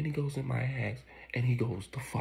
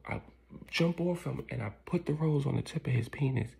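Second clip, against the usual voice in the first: ordinary talk, nothing marked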